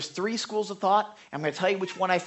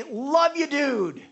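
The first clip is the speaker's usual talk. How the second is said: gushing affection; 7 semitones higher than usual. Retold or imitated. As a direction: pour it on warmly. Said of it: "Or I'll just say".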